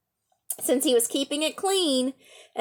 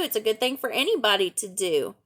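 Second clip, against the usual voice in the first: ordinary delivery, nothing marked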